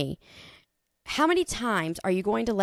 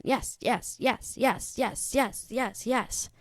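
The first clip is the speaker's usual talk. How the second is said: lightly voiced eager yes-man, a bit higher; 4 semitones higher, 4 dB quieter, much slower than usual. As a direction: nod along like a flatterer agreeing to everything. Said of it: "yes men".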